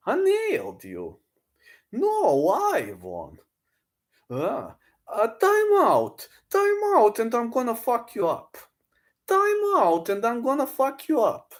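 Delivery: very high strained put-on voice; Charlie Zelenoff impression. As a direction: ham up the deluded fighter. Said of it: "Charlie Zelenoff beat Wilder".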